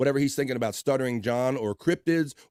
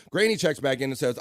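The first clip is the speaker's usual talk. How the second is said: normal talking voice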